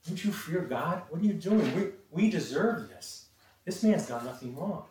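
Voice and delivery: acted-out indignant challenge; indignant rebuke. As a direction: take a fellow criminal to task, voice lifting. Acted rebuke of the other thief.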